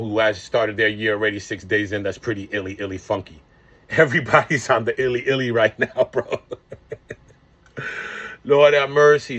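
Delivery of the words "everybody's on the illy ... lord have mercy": chuckling through the words; amused, laughing through a rough week. Real, not acted. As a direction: laugh it off while talking. Laughing at his own rough start.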